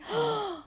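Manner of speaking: high, sharp gasp; horrified gasp. A narrator's reading. Boy suddenly remembers the letter, horrified gasp.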